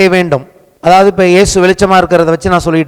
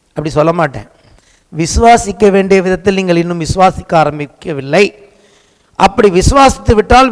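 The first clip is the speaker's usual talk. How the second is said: ordinary delivery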